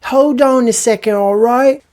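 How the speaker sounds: high, loud mock UK lad; chavvy British heckler. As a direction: call out in a put-on British street voice. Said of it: "He goes".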